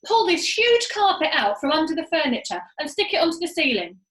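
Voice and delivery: loud, high command; shouted order. A narrator's reading. Monkey father ordering the carpet pulled, loud shout. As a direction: bark the order. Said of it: "shouted Muggle Rump".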